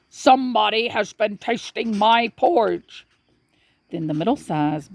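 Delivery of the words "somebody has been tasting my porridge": lightly voiced big papa bear, a bit higher; great big bear voice. A narrator's reading. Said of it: "said in his great big voice".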